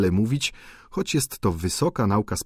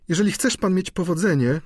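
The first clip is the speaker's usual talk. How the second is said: plain reading voice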